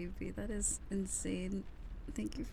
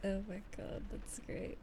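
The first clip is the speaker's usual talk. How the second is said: ordinary delivery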